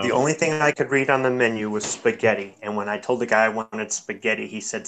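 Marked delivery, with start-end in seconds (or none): none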